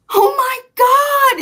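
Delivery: high, loud, drawn-out cry of shock; freaking out. Retold or imitated. Wife reacting to husband's firing, freaking out. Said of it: "like, freaking out".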